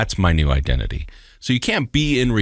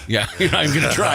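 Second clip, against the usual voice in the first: laughing; 8 semitones higher, faster than usual. Real, not acted. Teasing about name.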